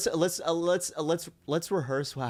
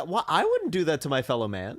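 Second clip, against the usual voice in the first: usual speaking voice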